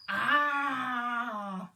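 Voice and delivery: loud pirate "Ah!" shout; pirate roar. Pretend. Leading the children in a loud pirate roar. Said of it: "Great pirate noises".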